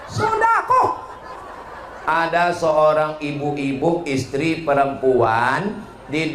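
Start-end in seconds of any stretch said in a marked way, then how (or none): 0.0-1.0 high, ringing final word; a sudden high burst of emphasis; emphatic punchline. Real, not acted. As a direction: land the answer with a lift. Punchline answer to the congregation.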